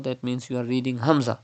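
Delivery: normal talking voice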